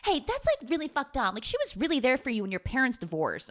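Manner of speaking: very high, quick mock teen scold; mock scolding friend. A girl scolding another in the fight, in a very high mock voice. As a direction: squeal it out like a shocked friend. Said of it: "And then someone's like".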